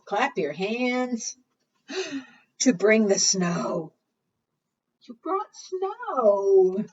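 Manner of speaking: ordinary talk, nothing marked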